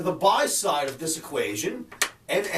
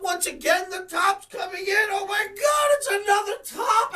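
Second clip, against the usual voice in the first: mocking top callers; 10 semitones higher than usual. Host mocks chat-room traders calling the top, shrill mock panic. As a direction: ham up the doomsayers' alarm, pitched way up.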